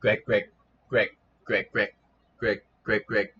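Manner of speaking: short repeated quacks; duck quack imitation. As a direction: quack like a duck in quick bursts. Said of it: "the next sound".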